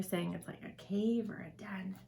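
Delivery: quick whisper, like a secret; whispered aside. Real, not acted. Aside to listening children.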